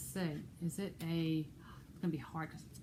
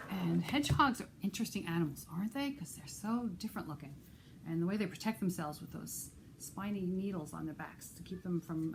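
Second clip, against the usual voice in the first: usual speaking voice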